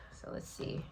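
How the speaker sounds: breathy whisper to herself; whispered aside. Real, not acted. Reader whispers to herself while drawing a card. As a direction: murmur it under your breath as you draw.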